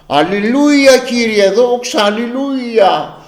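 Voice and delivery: high, drawn-out hallelujah cries; high-pitched mock fervent prayer; imitated praise shouting. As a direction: mimic someone crying out praises to God. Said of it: "και να φωνάζει".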